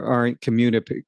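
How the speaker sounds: ordinary talk, nothing marked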